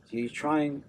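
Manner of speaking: ordinary delivery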